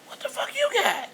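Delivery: high, loud accusing shout; outraged demand. Retold or imitated. Mother shouting at Tony for drugs, high and loud. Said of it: "demanding answer from Tony".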